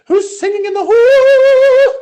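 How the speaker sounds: a Welsh choir teacher calling down the hall in song; Welsh accent. Retold or imitated. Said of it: "So she had an accent".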